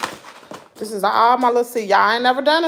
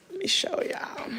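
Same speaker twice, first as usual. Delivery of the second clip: whispering; 11 semitones higher, 11 dB quieter than usual. Real, not acted. Aside whisper while setting up.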